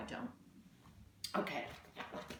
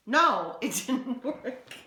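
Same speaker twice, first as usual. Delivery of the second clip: loud no dissolving into slow, helpless laughter; amused laughing; 3 semitones higher, 4 dB louder, slower than usual. Real, not acted. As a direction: laugh through admitting it failed. Binder clip attempt fails.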